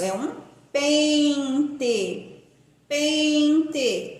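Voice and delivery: pente drawn out very slowly, nasal en; teacher dictates a word; slow spelling demo. Shown as a demonstration. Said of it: "Escrito com N".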